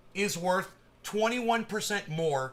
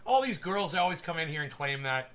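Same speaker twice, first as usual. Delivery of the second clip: ordinary talk, nothing marked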